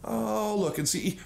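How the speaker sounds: normal talking voice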